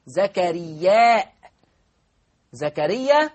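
"زكريا" mit deutlicher Hamza am Ende, lauter; "زكريا" mit klarem Stimmabsatz nach dem Alif; Hamza hörbar. Shown as a demonstration. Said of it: "بإثبات الهمزة هكذا".

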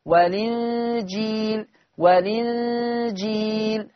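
والإنجيل slow, lam with kasra, hamza dropped; naql demo. Shown as a demonstration. Teacher slowly shows transferring the hamza's vowel. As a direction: stretch والإنجيل slowly as wel-lingil. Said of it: "المنطوق لام مكسورة".

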